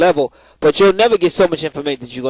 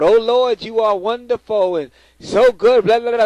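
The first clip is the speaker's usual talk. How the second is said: higher, slower churchy prayer voice; mock preachy prayer; 6 semitones higher than usual. Prayer line worker's mock praise to God, higher and slow. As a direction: parody the prayer line's praise. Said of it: "they would go".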